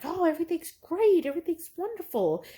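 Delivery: high, bright bubbly gush; bubbly and happy. Retold or imitated. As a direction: chirp it out, all sunshine. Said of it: "bubbly and happy and be like".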